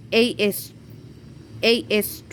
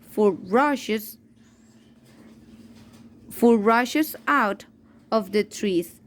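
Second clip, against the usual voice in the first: ordinary delivery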